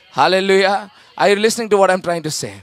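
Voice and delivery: ordinary delivery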